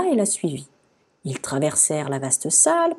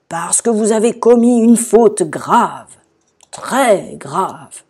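loud, slow, solemn accusation; stern guard; 3 semitones higher, 7 dB louder, slower than usual. A narrator's reading. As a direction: spell out the offence like a grave guard. Garde sévère accusant la visiteuse. (garde sévère, fort et lent)

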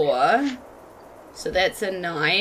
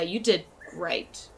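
ordinary talk, nothing marked

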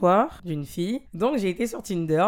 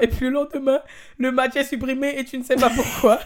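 about to laugh; 5 semitones higher, 5 dB louder than usual. Real, not acted. Reading advice, about to burst out laughing.

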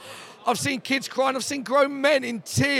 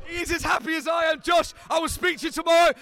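shouting over the crowd; 7 semitones higher than usual. Real, not acted. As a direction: shout to be heard above the fan zone noise. Shouting sign-off over crowd noise.

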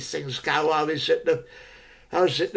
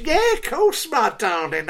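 put-on eager old suitor; 6 semitones higher than usual. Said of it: "I was".

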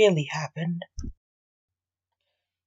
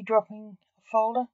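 plain reading voice

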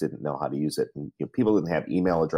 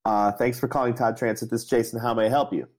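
higher, louder repeat of the greeting; a mock-polite greeting pitched up; louder phone greeting; 6 semitones higher than usual. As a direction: say it again, pushing to be heard. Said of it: "And I said".

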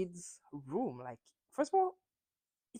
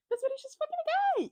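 excited squeal; much faster than usual. Real, not acted. Repeats her favorite line.

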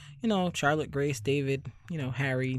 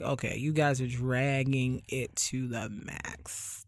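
slow, drawn-out, softer weary complaint; weary exasperation; 2 semitones lower, 6 dB quieter, much slower than usual. Retold or imitated. Telling the show's writers they drag the story, slow and weary. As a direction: drag the words out, fed up.

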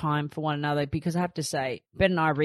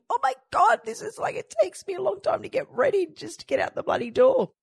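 much higher, frazzled mock panic; frantic exasperation. Mum running late with crying twins, high frantic exasperation. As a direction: squeal it like a frazzled new mum. Retold panic getting ready.

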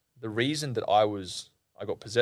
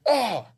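sudden high "oh" of shock; imitated cry; 12 semitones higher, 4 dB louder than usual. Storyteller acting the healer suddenly hurt, high imitated cry. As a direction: cry out sharply, pitch jumping up.

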